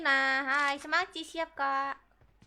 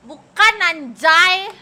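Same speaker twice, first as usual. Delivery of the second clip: shocked protest; 11 dB louder, much slower than usual. Real, not acted. Protesting loud gasp.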